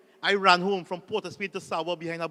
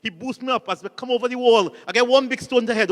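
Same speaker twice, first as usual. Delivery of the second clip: ordinary delivery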